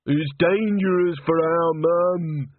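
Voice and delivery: put-on high, slow, fretful young man's voice; a grieving simple lad, slow and high; tearful simple young man. Walter mourning the chorus master, high and slow.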